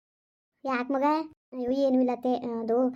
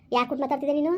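usual speaking voice

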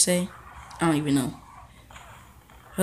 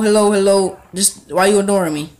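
ordinary talk, nothing marked

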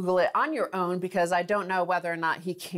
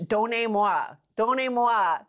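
put-on Boris Johnson doing clumsy English-accented French; mock bad French; 5 semitones higher than usual. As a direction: mimic a Brit's clunky French, a bit higher.

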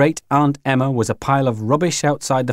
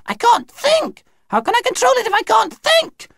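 very high panicked cry; high-pitched hysteria; hysterical human voice. Acted. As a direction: wail helplessly, losing control. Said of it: "in a human voice".

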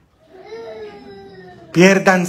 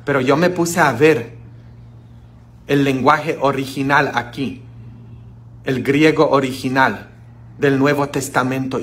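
ordinary talk

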